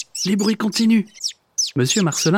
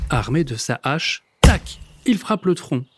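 plain reading voice

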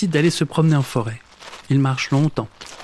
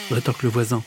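ordinary delivery, nothing marked

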